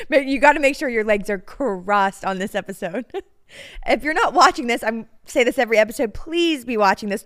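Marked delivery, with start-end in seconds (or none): none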